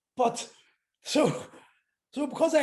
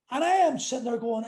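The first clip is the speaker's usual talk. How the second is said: ordinary talk, nothing marked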